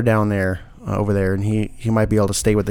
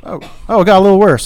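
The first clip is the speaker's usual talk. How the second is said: sarcastic; 8 semitones higher, 5 dB louder, faster than usual. Real, not acted. Ironic echo of bad news.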